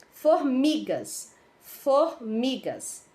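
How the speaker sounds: FORMIGAS said slowly, syllables set apart; pronouncing slowly. Shown as a demonstration. Teacher pronouncing formigas for pupils, slowly and deliberately. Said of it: "Estou apenas pronunciando".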